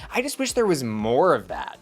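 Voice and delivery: ordinary talk, nothing marked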